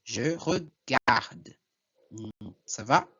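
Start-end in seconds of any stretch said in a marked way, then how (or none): 0.0-1.6 slow Je regarde with the last E silent; stop on the D. Shown as a demonstration. Teacher shows where pronunciation stops, slow Je regarde ending on D. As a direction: draw out Je regarde and end on the D. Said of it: "paro aqui a minha pronúncia na letra D".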